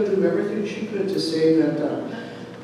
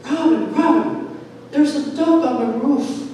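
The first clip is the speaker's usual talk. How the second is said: higher, urgent calling out; excited friend; 8 semitones higher, 4 dB louder than usual. Retold or imitated. Friend running in after the service, excited. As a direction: imitate a friend bursting in with news. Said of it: "came running in and said".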